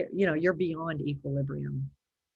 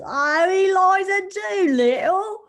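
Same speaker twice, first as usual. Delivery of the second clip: high, slow Cockney Eliza Doolittle voice; Eliza Doolittle impression; 14 semitones higher, 8 dB louder than usual. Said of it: "she usually just goes straight for Eliza".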